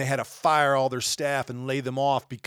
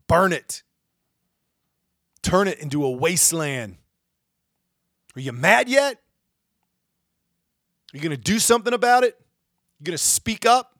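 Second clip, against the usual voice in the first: higher, louder furious yelling; a raging call to get angry; angry shout; 5 semitones higher, 7 dB louder than usual. Real, not acted. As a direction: shout it to rouse the crowd. Angry rant calling viewers out.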